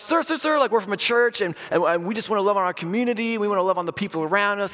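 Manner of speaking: high-pitched, hurried-sounding appeasement; flustered placating. Retold or imitated. Pastor reassuring a startled stranger they're from church, flustered placating.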